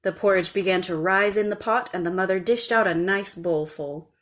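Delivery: ordinary delivery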